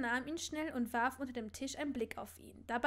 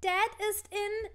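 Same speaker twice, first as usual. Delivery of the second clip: put-on child blurting; 9 semitones higher, 6 dB louder, slower than usual. Scorpius blurts, mouth covered.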